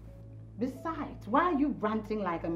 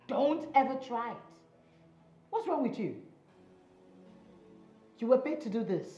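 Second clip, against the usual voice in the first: higher-pitched, sharp warning; indignant rebuke; 6 semitones higher than usual. Acted. Woman rebukes man for shouting at her, indignant. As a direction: put the offender firmly in their place.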